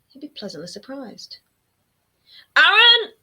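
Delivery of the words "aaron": shrill, loud cackling shout of a name; mother cackling her son's name from the doorway; cackled call. A narrator's reading. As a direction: cackle the name across the room. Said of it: "his mother cackled".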